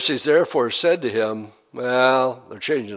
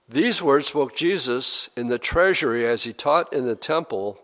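normal talking voice

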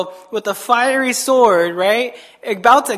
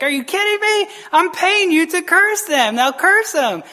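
high-pitched indignant outburst; mock fury; 8 semitones higher than usual. King berating the prophet he is paying, mock fury. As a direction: play an outraged boss demanding results. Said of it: "The king's furious".

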